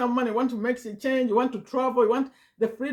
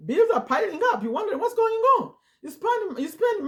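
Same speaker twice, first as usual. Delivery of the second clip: laughing; 12 semitones higher, 3 dB louder than usual. Real, not acted. Laughs about bills piling up.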